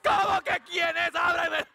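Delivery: very high, loud screaming at the door; hammed-up panicked scream; frantic acted shout. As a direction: yell desperately to be let in. Acts himself frantic at the gate.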